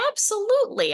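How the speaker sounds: a bright mock-eager burst; eager yes. Retired woman agreeing to mail cat food, imitated eager exclaim.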